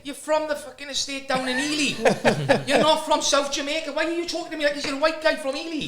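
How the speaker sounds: high, incredulous, laughing rant; mock indignant telling-off. School worker to kid from Ely, mock outrage. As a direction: berate the kid in amused disbelief.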